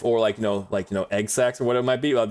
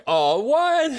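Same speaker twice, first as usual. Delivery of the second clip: much higher, louder startled oh what; surprised squeak; 4 dB louder than usual. Real, not acted. Streamer teased by Cito in chat, high startled yelp. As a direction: jump up in mock outrage.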